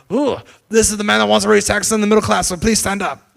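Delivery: hammed-up taunt at a rival in Parliament; mocking taunt. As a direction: jeer across the chamber. Boris mocking Corbyn at PMQs.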